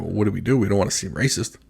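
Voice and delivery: low, soft, worried aside; discreet consulting. Retold or imitated. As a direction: confer softly, keeping it discreet. Couple confer discreetly near the man.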